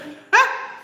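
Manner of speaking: brief high cackle at oneself; short high laugh. Real, not acted. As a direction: let out one quick, high laugh. Laughs after mascara aside.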